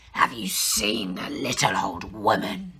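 deep whispered tiger growl. Acted. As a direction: talk low and breathy like a prowling tiger. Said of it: "said the tiger".

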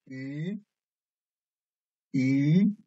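鱼 in second tone, climbing upward; tone drill. Shown as a demonstration. Teacher reading for students to circle, 鱼 rising. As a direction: lift 鱼 steadily from middle to high. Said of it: "focus on the tones".

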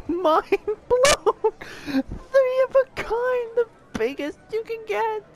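high shrieking joy; ecstatic disbelief. Real, not acted. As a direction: shout it in disbelief. Still reeling from the win.

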